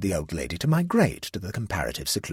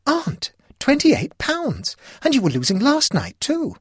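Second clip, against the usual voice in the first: shocked; 6 dB louder than usual. Acted. Niece shocked at aunt's losses.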